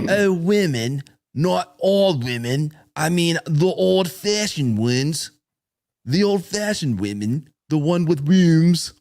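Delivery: high, slow mock British accent in a sneering comic drawl; Ricky Gervais accent. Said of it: "I'll do it in his accent".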